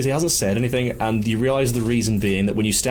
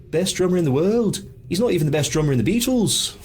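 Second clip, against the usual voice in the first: high-pitched Liverpool accent impression; 9 semitones higher than usual. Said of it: "to which [name] replied".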